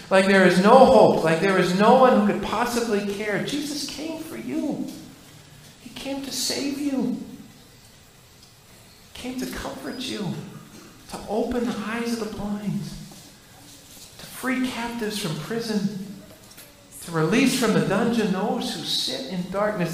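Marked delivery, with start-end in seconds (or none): 3.4-12.8 softer, tender pastoral voice; gentle reassurance. Acted. Pastor assuring hurting listeners that Jesus came, tenderly. 12.8-16.1 much softer and slow, almost hushed. Acted. Pastor repeating the promise to the hurting, softly and slowly.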